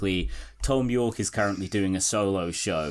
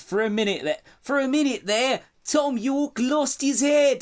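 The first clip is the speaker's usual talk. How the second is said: put-on Australian accent; 14 semitones higher than usual. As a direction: read it in a bad Australian accent. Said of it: "I can't do an Australian accent".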